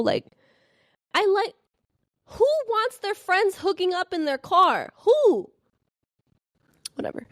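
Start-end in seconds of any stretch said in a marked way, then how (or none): 1.1-7.3 high incredulous outburst; exasperated. Real, not acted. Ranting to viewers about the car, incredulous and high. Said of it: "exasperated rant".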